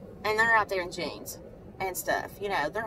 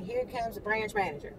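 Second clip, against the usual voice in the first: usual speaking voice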